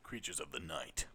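low, dramatic creatures-of-the-night voice; spooky voice. Pretend. Friend riffing on the pets, deep spooky intoning. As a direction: intone it like a horror host.

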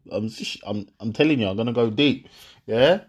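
usual speaking voice

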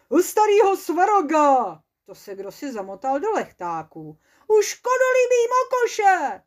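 loud, high oath from the trap; trapped dwarf swearing by the gods; cursing shouts. Acted. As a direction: yell the curses, caught and furious. Strange cries from the trap.

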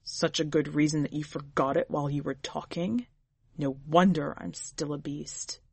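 low acidic husband's voice. A narrator's reading. Husband taunting Leah in her imagination, acidly. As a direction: sneer it low, with acid in every word. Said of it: "hear the acid in his voice".

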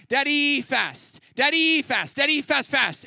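put-on high, loud little-kid pleading; imitating toddler son. Dad imitating toddler Levi begging, high and loud. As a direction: chant it like an eager two-year-old. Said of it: "he's like".

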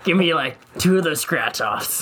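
raspy smoker voice, slow; rough smoker's rasp on each word; cigarette voice. Pretend. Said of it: "you have to do it in your cigarette voice".

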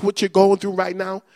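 ordinary talk

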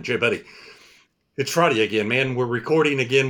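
ordinary talk

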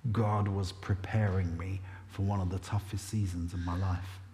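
soft unvoiced whisper; hushed whisper. Real, not acted. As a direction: drop into a solemn whisper. Solemn reflection on hard season.

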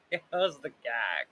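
ordinary delivery

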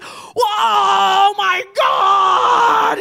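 high, loud shocked cry; a man seeing his bloody eye in the bathroom mirror; horrified shout. Retold or imitated. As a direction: gasp it out in horror.

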